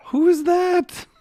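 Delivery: high, slow gasp of awe; mock gasp at a glamorous picture; gasping mock surprise. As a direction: act stunned by the photo. Gasps at glamorous photo.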